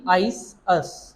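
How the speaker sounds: आइस, अस stretched very slowly on the hissing s; teacher slowly sounding out आइस, अस; slow s demo. Shown as a demonstration. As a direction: draw out the s in आइस and अस. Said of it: "एस में देखो सील".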